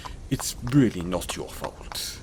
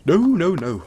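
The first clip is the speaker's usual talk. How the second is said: emphatic refusal; 8 semitones higher, 9 dB louder than usual. Acted. Refusing payment from the robbed traveller.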